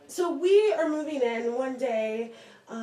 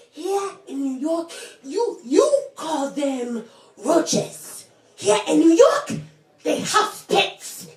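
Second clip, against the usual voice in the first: uncle's punchline; 2 semitones higher, 4 dB louder, slower than usual. Retold or imitated. Uncle leaving tells the newcomer about roaches, slow and building. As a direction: draw it out like a gleeful uncle delivering wisdom.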